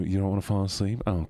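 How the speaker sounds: usual speaking voice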